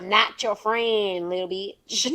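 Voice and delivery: loud, very slow mock scolding; imitating Mama, stretched and forceful; mother's telling-off. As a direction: lay down the law like Mama. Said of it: "she tells me all the time".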